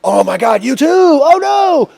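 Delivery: rising to a high, loud wail; mock dismay. Seeing a competitor walk into jail, mock dismay. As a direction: cry out in exaggerated dismay, retold.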